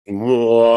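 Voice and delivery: long, drawn-out ghostly moan; imitated ghost wail. Man shows what the ghost soldier did, imitated long wail. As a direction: let out the soldier ghost's sustained howl. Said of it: "It made that sound?".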